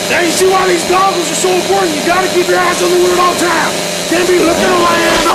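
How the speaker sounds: high-pitched, loud voice through pain; high strained pain. Acted. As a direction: push through agony, voice cracking upward. Injured badly, voice climbs.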